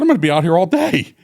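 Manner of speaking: high, pleading protest. Retold or imitated. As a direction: whine it's too hot out here. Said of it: "I said".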